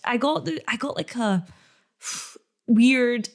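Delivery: ordinary talk, nothing marked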